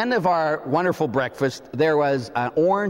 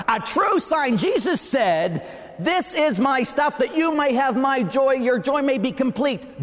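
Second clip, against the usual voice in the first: fervent preaching; 9 semitones higher than usual. Real, not acted. Preaching with rising fervor.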